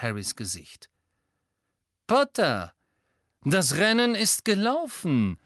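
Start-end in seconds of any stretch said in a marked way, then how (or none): 2.1-5.4 drawling. A narrator's reading. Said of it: "sagte Lucius Malfoy gedehnt".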